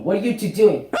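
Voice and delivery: usual speaking voice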